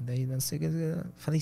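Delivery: usual speaking voice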